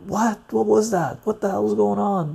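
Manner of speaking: imitating puzzled friends after the film; confused girls. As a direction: play the bewildered friend, pitched high.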